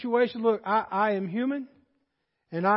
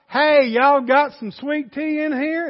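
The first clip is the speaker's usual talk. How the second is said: slow, raised, drawn-out Southern drawl; milked Southern drawl; 4 semitones higher, 6 dB louder, slower than usual. Acted. Southerner asking for sweet tea up North, milking the drawl. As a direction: stretch every word out in a thick Southern drawl. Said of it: "just really milk it out".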